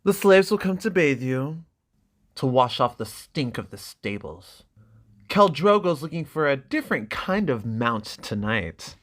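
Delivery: lightly voiced cruel brother taunting; sneering brother. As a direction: sneer at your little sister. Viserys mocking his sister.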